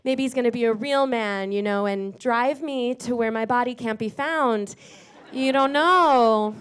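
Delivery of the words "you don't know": slow, mock-ominous aside; ominous hint. Comic teasing the crowd about a date gone wrong, mock-ominous. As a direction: drag it out like a dark warning.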